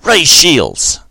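sharp, loud, high battle command; urgent shout. A narrator's reading. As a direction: bark the order in alarm. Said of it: "said without thinking".

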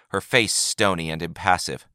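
usual speaking voice

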